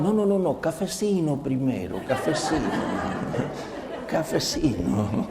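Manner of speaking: imitating relaxed colleagues while chuckling; laughing imitation. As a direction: laugh through the cafecino lines. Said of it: "Hasta decían".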